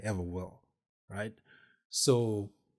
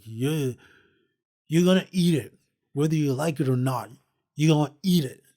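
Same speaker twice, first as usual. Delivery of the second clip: higher, louder, insistent mock yell; bossy shout; 5 semitones higher, 8 dB louder, faster than usual. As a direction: push the jam on him, loud and bossy. Cousin forcing jam, shouting.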